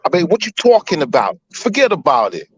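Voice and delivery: put-on New York wiseguy accent. Shown as a demonstration. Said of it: "I'm better at doing New York accents".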